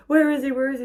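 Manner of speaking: high, loud retold demand; frantic high-pitched questioning; urgent pleading. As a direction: re-enact frantic searching, pitched right up. Retold urgent question at hospital desk.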